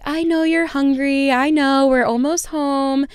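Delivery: put-on dad soothing baby in front of strangers; soothing dad imitation. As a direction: coax the hungry baby gently. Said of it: "And he's like".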